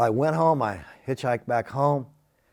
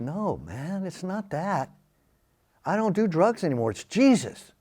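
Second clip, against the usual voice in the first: softer, gentle and peaceful; calm friend; 5 semitones higher, 3 dB quieter than usual. Retold or imitated. Friend gently telling him he quit drugs, soft and peaceful. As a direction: quietly tell him it's Jesus. Friend calmly explaining he's changed.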